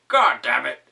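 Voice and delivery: hammed-up scream. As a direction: scream as you fall over. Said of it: "it screamed".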